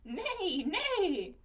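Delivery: whinny it like a horse; horse neigh. Acted. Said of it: "The horse says".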